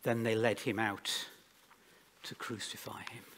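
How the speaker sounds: measured, dramatic Bible reading with spaced phrases; scripture reading. Acted. Preacher reads the Passion to the congregation, solemn public reading. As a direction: read the Gospel aloud, pausing between phrases.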